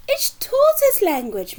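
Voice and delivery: high, loud, pleased announcement; squeaky triumphant reveal; proud Mister Hoppy. Acted.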